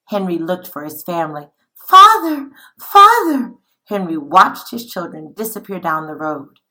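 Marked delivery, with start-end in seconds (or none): 1.7-3.5 high anguished cries of Father; crying out for father. Acted. Children dragged away, shouting for their father. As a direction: call desperately after the vanishing children.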